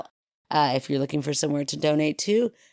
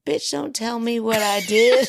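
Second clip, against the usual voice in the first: laughing mock outrage; 8 semitones higher, 5 dB louder than usual. Real, not acted.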